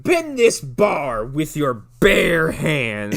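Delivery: mock-dramatic drawn-out order; Tim Robbins. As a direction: stretch it out theatrically, punching the words. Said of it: "He's like".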